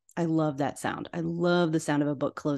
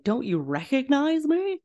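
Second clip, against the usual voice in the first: lightly voiced ghost, pitched up; ghost's question; 8 semitones higher than usual. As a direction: lilt the question high, lightly in character. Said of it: "he's like".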